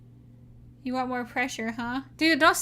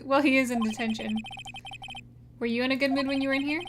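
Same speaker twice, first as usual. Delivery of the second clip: ordinary delivery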